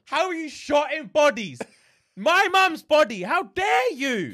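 very high, indignant wail; hammed-up outrage. Son protests his mum's body was sold, high-pitched outrage. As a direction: stretch it out in scandalised mock fury.

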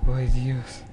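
loud, rattled talk after a fright; shaken and panicky. Real, not acted. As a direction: fret loudly, too scared to move. Shaken after the jump scare.

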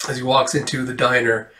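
ordinary talk

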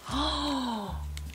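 sharp, high gasp of surprise; long astonished intake of breath; delighted gasp. Real, not acted. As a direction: gasp as you see the gift. Opening the advent calendar gift.

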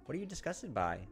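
reading the novel's text with quiet dread; tense story narration. Acted. Streamer reading Higurashi narration to viewers, tense narration. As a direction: narrate it like a creeping horror story.

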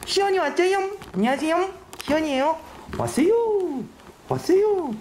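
put-on very high baby-talk greeting; squeaky little aegyo hello; cutesy voice. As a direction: put on over-the-top cuteness. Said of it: "귀여움을 견뎌야지".

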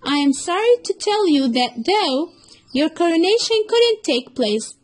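a fox finally judging the cruel elephant; accusing fox. Acted. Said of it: "said the fox".

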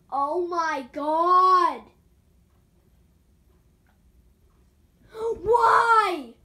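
slow, loud, high wailing; hammed-up raging. Vlogger fake-raging stuck on the climb, slow loud wail. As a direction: stretch every word like a fake meltdown. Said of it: "pretending like I am to make you guys laugh".

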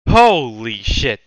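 loud, slow amazed exclamation; stunned outcry. Real, not acted. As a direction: blurt it out in shock, stretched. Shocked by a movie ranking.

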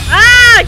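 loud, high "ah" of alarm; startled scream. Real, not acted. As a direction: yelp as the boss attacks. Attacked by the boss.

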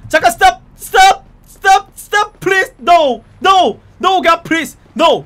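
loud, high desperate shouting; shouted commands. Pretend. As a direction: yell after a runaway. Cop chasing fleeing driver. (도망자를 쫓으며 외치는 다급한 고함)

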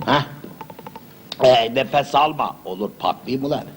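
usual speaking voice

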